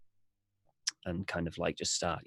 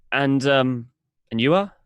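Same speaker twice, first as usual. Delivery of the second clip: polite traveler; 5 semitones higher, 8 dB louder than usual. Acted. Alfred questions the innkeeper.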